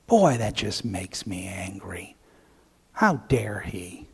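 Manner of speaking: simmering anger kept under the breath; older son fuming in a low whisper; quiet seething. Pretend. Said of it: "Man, I'm angry".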